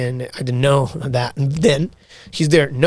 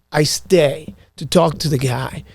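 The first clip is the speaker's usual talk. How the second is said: ordinary talk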